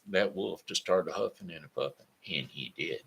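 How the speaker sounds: ordinary delivery, nothing marked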